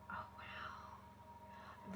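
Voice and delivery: gasp, then a hushed whispered wow; awed whisper. Real, not acted. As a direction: breathe it out in awe. Gasps at the card's message.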